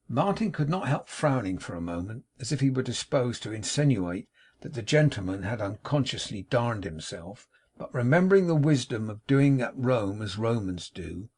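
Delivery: plain reading voice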